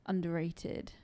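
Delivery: usual speaking voice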